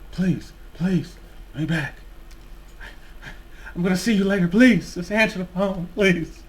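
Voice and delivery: high, pleading, whiny voice; desperate ex. Retold or imitated. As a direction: beg like a desperate ex. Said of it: "this comes off as a very desperate ex-boyfriend".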